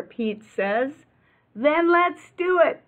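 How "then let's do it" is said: high, loud rallying cry; rally the crew; cheerful agreement. Acted. Workers agreeing with Pete, high and loud.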